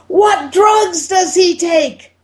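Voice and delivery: high, slow shouted question; screaming. Retold or imitated. Doctor screaming the question at the patient's wife. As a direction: yell it slowly at the wife. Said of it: "screaming at the top of his lungs".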